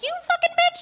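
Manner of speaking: very high, squealed mock rage; enraged monkey. Monkey sister bursting in on the couple, high-pitched mock fury. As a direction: squeal it as a furious little monkey.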